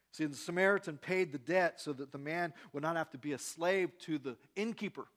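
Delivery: rising preaching. Acted. Preaching at full force.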